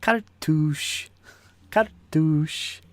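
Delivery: "Cartouche" with a rolled French r, loud; hammed-up French "Cartouche"; mock French. Shown as a demonstration. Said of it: "She says it differently".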